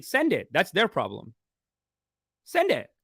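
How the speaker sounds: defiant dare. Pretend. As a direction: toss it back like a dare. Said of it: "email them back saying".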